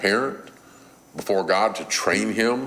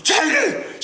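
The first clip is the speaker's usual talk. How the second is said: shrill mock scream of the boy's name, much higher and louder; screaming mom; 4 dB louder than usual. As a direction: shriek his name like a furious mother. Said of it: "She's screaming".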